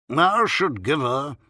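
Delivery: slow, loud, weighty doctor's pronouncement; pompous doctor. A narrator's reading. Doctor prescribing for the sick child, pompous and slow. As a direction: draw the words out with great self-importance. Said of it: "in a very wise tone of voice".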